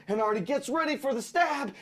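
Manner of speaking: ordinary delivery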